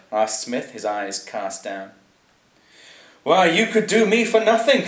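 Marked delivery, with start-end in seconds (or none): 3.2-4.9 high, loud lordly musing, no real murmur; hammed-up aristocratic aside. A narrator's reading. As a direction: muse aloud in the lord's high voice. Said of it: "Lord Chatterley murmured".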